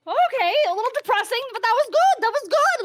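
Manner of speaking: high, loud crowd voice going okay; a crowd half pleased, voiced high and loud; moderate crowd reaction. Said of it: "So you get, like, a moderate".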